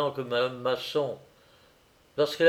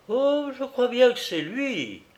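much higher-pitched startled witness; high-voiced cry of recognition; woman's exclamation; 11 semitones higher than usual. A narrator's reading. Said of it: "puis elle s'écria".

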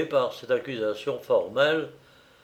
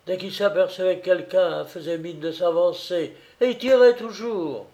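lightly voiced high female testimony; higher-voiced witness, rising in intensity; woman witness; 5 semitones higher than usual. A narrator's reading. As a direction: recount it excitedly in a raised pitch.

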